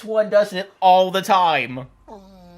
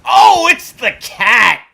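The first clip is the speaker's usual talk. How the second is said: excited exclamation; 5 semitones higher, 10 dB louder, slower than usual. Real, not acted. Surprised cat wins fight.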